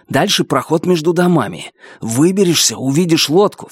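ordinary delivery, nothing marked

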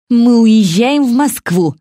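в ярости, брызгая слюной; spluttering. A narrator's reading. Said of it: "брызгая слюной". (в ярости, брызжа слюной)

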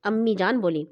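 usual speaking voice

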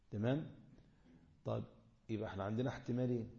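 usual speaking voice

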